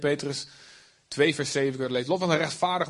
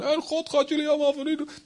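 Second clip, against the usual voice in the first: mock fire-and-brimstone, much higher; doom preacher; 12 semitones higher than usual. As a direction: parody a threatening preacher. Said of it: "Niet zo van".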